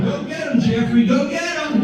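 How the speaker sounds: high, cheering mock parent. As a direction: egg the toddler on in a high voice.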